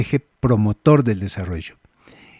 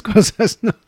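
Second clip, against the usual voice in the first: ordinary delivery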